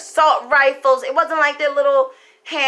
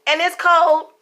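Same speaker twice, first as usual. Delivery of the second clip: indignant; 6 semitones higher, 4 dB louder than usual. Retold or imitated. Outraged quoted complaint, retold.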